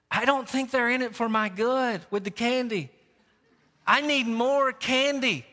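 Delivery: dad mimicking his candy-hungry girl; little daughter. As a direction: plead the child's case with mock conviction.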